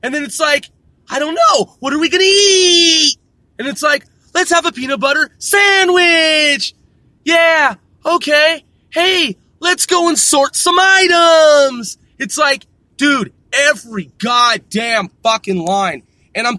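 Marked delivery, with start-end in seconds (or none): 1.1-3.2 high mock-kid shout; exaggerated cartoon girl voice; imitating cartoon girl. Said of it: "starts to talk like this". 4.3-6.7 high singsong, last word held long; mock little-girl cartoon line swelling on the final word; exaggerated kids' show voice; imitating cartoon girl. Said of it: "starts to talk like this". 7.2-12.0 high, slow singsong; mock little-girl voice with a long loud final word; hammed-up kids' show cheer; imitating cartoon girl. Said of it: "starts to talk like this".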